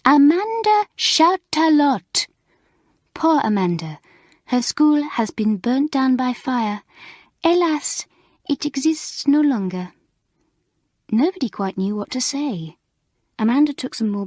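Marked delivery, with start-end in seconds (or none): 0.0-9.9 put-on high, excitable French teacher voice with a French accent; bubbly French mistress, lively French accent; French accent Mamselle.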